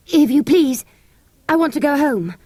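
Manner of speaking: Robert begging the soldiers; timid boy pleading. A narrator's reading. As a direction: ask nervously to go home.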